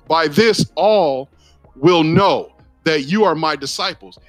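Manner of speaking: ordinary talk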